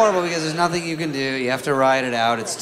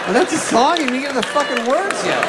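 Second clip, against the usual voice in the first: mock outrage; 10 semitones higher than usual. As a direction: rant it like you're fed up. Indignant outburst.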